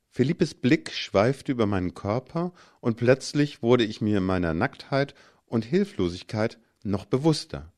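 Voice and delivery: plain reading voice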